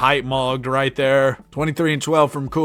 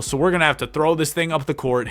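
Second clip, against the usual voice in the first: ordinary talk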